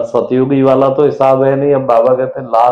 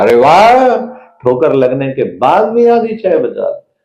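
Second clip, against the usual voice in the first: husband praising wife for saving half the tea; enthusiastic praise; 2 semitones higher than usual. Retold or imitated. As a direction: burst with encouragement. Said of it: "उत्साह पैदा कर दिया".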